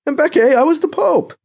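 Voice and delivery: high, loud indignant protest. Acted. BC reminding Mbeke who he was, protesting. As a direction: object with pitch shooting up. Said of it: "B C protests".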